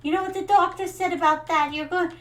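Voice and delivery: high, loud Jersey mom nagging; a Jersey mother scolding in a thick Jersey accent. Retold or imitated. Jersey mother nagging daughter about vitamins in Jersey accent. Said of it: "Now, a Jersey accent".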